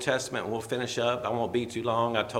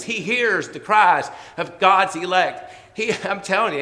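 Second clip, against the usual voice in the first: ordinary talk, nothing marked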